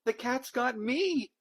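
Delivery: very high, slow shrieking cry. A narrator's reading. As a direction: shriek it in fright. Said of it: "shrieked Rosie".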